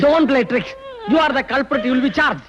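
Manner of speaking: high, forceful accusation; accusing. Acted. Officer telling the woman she will be charged, accusing.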